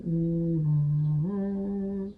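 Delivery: short toned hum; demo hum. Shown as a demonstration. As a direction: hum the note to show it. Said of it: "vibrational practices like humming".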